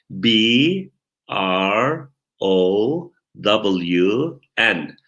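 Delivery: English letters, slowly, one at a time; spelling out. Shown as a demonstration. Teacher spells a surname for students, slow letter-by-letter spelling. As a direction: leave a long gap after each letter. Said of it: "Bakın, heceliyorum".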